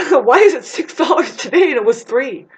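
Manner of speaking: high, loud indignant squawk at the price; mock outraged. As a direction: play a shocked shopper yelling at the pump. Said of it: "you're like".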